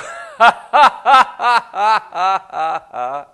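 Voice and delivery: loud, high burst of laughter; a full, real belly laugh; big laugh. Real, not acted. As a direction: throw back your head and laugh out loud. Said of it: "just go".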